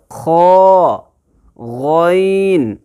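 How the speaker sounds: ख and गैन drawn out slowly from the top of the throat; tajweed letter demo. Shown as a demonstration. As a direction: sound out ख, then a long stretched गैन. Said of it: "पढ़ने का तरीका क्या रहेगा?".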